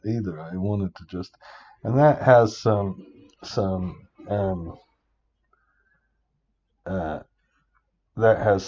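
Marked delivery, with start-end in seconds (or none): none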